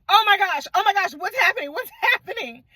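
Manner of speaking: high, frantic repeated gasps; hammed-up panic; mock panic. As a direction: play a flustered crowd losing it. Crowd panicking after the murder.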